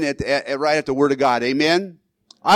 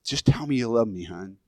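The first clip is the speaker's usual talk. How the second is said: soft plea to wife; 6 semitones lower, 6 dB quieter than usual. Retold or imitated. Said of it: "I want to hear it all the time".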